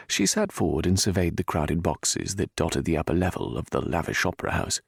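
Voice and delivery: ordinary delivery, nothing marked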